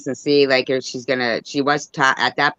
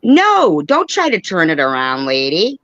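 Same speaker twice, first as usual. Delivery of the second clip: indignant objection; slower than usual. Real, not acted. Host interrupting the woman on the video, indignant objection. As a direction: cut them off with a sharp rise.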